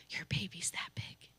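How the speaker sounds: soft secret whisper, quick. Real, not acted. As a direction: lean in and breathe the secret. Revealing pregnancy with a pea.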